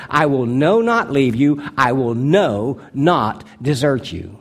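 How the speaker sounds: "no not" hit as separate, weighty words; most emphatic. Shown as a demonstration. Preacher stressing the Greek wording to the congregation, most emphatically. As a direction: stress "no" and "not" hard, drawing out "no not desert you". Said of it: "it's said in the most emphatic way".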